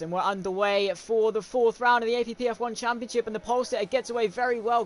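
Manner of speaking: loud, high, excited race commentary; live play-by-play. Acted. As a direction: call the race start at full pitch.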